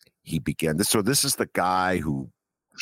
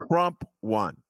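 usual speaking voice

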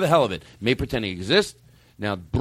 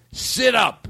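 snapped high order to sit up; sharp order; 9 semitones higher, 4 dB louder than usual. Acted. Host ordering a slouching guest, sharp and high.

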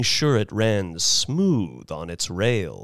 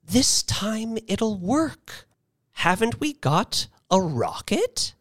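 rising hope; 11 semitones higher than usual. A narrator's reading.

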